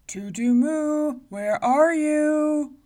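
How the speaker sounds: loud, slow booming giant voice; a huge giant calling out, slow. A narrator's reading. Giant at the door calling for the girl, booming. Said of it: "Then he called".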